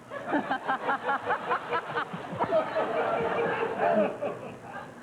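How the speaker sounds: breathless real laughter, drawn out; helpless cracking up at an awkward question; long laughing fit. Real, not acted. Host cracks up asking about nature's call, long helpless laugh.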